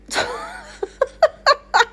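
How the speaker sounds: high burst of nervous laughter; laughing in disbelief at the fall; shocked laugh. Real, not acted. Streamer laughs in shock after falling on stream, high nervous laugh.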